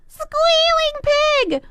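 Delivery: pig squeal. Acted.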